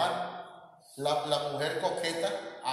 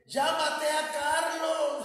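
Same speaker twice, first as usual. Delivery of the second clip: high, drawn-out holler to someone far off; imitated grandma yelling for a relative, high and sustained; grandmother's calling shout; 12 semitones higher, 4 dB louder, much slower than usual. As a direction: holler the names, stretching the last syllable. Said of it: "decía mi abuelita".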